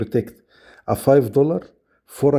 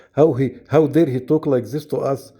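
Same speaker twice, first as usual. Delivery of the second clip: ordinary talk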